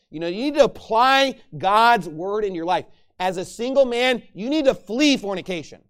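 fervent preaching. Acted. Pastor charging single men in church, fervent preaching. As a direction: press the young men hard.

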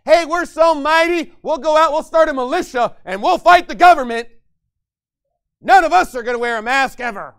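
loud, hammed-up militia bravado; mock tough talk. Pastor mocking gun-proud churchgoers with loud bravado. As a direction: puff up and boast like a swaggering gunman. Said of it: "You say".

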